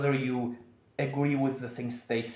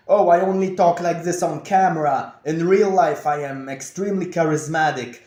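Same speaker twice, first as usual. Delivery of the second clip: defensive coping; 3 semitones higher, 7 dB louder than usual. Retold or imitated. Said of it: "I started immediately coping".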